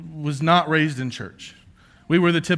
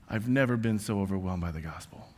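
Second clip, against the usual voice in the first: normal talking voice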